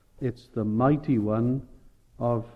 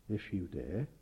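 quiet dare; 6 semitones lower, 15 dB quieter than usual. Acted. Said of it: "the loving, gentle pastor that he was".

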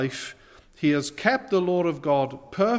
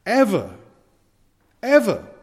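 rising preacher's cry; 6 semitones higher, 7 dB louder than usual. Real, not acted. Preacher climaxes on repeated word.

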